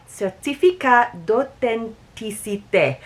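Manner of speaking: slow, careful French accent. Shown as a demonstration. Host reading the label to viewers in French accent. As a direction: Certificat d'authenticité said slowly in French. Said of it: "I try to do my best French accent for you".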